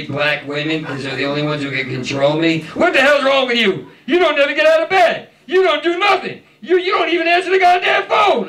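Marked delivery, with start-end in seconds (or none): 2.7-3.9 mock angry scolding woman. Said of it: "she's like". 4.1-8.5 much higher, shouted rapid scolding; shrieking nag. Retold or imitated. Acting girlfriend's rant.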